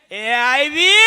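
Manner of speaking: loud call-out; shouted call. Retold or imitated. Conductor loudly calls out to the bus. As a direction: belt it out like a conductor.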